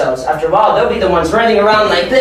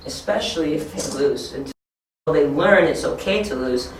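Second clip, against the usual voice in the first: ordinary talk, nothing marked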